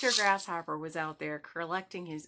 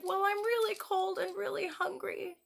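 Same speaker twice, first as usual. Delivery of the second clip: pleading grasshopper; 12 semitones higher than usual. Acted. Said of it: "He's like".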